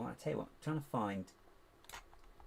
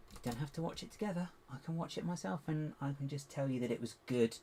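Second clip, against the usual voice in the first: ordinary delivery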